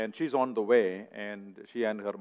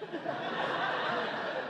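breathy laugh; 8 dB quieter than usual. Real, not acted. Laughs at punchline.

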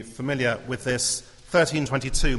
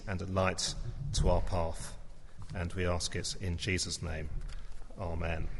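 prayer; 4 semitones lower than usual. Acted. Leads a prayer.

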